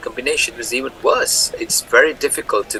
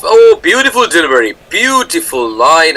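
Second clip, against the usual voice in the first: excited; 4 semitones higher, 6 dB louder than usual. Real, not acted. Commentator reacting to a delivery.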